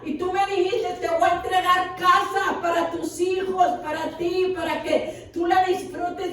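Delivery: voz aguda de súplica, imitando su llanto; reclamo lloroso a Dios. Retold or imitated. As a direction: revive un ruego dolido ante Dios, en tono alto. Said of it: "llorando ante la presencia de Dios". (súplica llorosa recordada, con la voz elevada)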